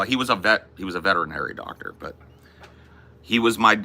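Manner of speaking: normal talking voice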